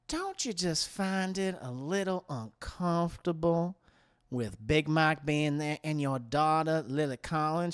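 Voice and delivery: soft, low, careful insinuation; delicate prying. Acted. Buzzard woman pries about Leanne's daughter, soft and insinuating.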